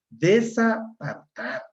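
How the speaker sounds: "desapartar" drawn out syllable by syllable; slow, deliberate wrong form "desapartar"; slow demonstration. Shown as a demonstration. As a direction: say "desapartar" slowly for the class. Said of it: "Palabra con prótesis".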